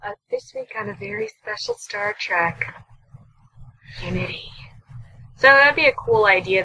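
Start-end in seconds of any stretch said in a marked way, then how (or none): 0.0-4.5 high, hushed promo voice for a very special episode; TV announcer. Pretend.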